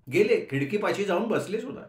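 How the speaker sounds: ordinary delivery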